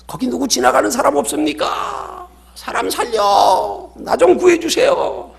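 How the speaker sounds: high-pitched, drawn-out cries; acted yelling for help. Climber hanging from a branch calls passersby, acted yelling. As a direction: call far out in a hammed-up desperate yell. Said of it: "소리소리 지릅니다".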